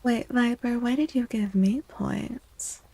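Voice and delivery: normal talking voice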